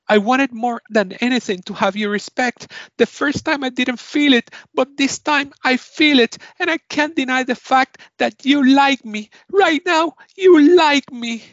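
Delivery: high, loud, effusive declaration; overjoyed acceptance speech. Acted. Game host acting out an award winner's speech, overjoyed.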